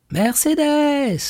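a high, drawn-out happy call from outside; joyful call. A narrator's reading. Edmond calling Mercédès joyfully from outside the house. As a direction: call her name joyfully from afar. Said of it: "cria une voix joyeuse au dehors".

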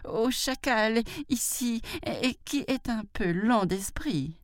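high-pitched, timid begging; pleading. A narrator's reading. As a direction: explain while begging, high and hesitant. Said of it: "supplia-t-il". (suppliant, voix aiguë et hésitante)

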